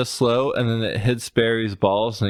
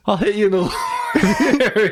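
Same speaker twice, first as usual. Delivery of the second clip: laughing mock threat; 8 semitones higher, 3 dB louder than usual. Real, not acted.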